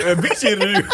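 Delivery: high, loud fake Japanese accent through laughter; mock Japanese accent. Co-host echoing the SNL skit, laughing mock Japanese accent. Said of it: "stupid Japanese".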